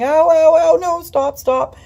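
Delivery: high, loud pained ow and stop; crying out in pain. Retold or imitated. Wife in pain as husband lifts her, crying out. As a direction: cry out for him to stop.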